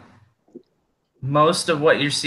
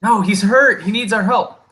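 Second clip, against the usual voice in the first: high, loud, pleading kobold voice; first kobold; 9 semitones higher, 4 dB louder than usual. Acted. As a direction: plead for a hurt comrade in a high voice. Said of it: "initial one saying".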